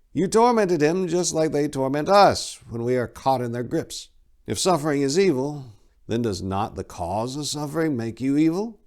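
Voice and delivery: put-on old abbot. A narrator's reading. Abbot Gelfus calming Tor in the courtyard, aged voice. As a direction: wise old abbot counselling patience.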